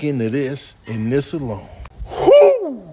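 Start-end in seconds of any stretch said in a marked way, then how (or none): 2.1-2.9 exasperated whoop. Acted. Exasperated at the veggie platter.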